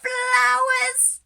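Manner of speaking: high, loud, delighted encouragement; excited cheering. Real, not acted. Teacher cheers the children growing into flowers, high and delighted. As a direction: cheer them on to stretch tall.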